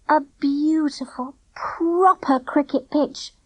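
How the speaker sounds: slow, high, dreamy tone; dreamily. A narrator's reading. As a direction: savour the dream. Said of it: "Clipper said dreamily".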